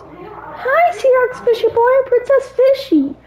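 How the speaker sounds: high-pitched pretend princess voice; Princess Fishy. Player voices his fishy girlfriend saying hi, high princess voice. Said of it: "let me do Princess Fishy voice".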